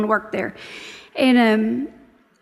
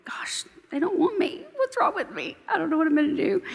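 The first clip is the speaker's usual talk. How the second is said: panicky; 6 semitones higher, 4 dB quieter than usual. Retold or imitated. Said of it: "You start getting panicky".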